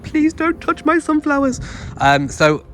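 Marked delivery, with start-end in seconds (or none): 0.0-1.6 pleading request. Retold or imitated. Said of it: "I said".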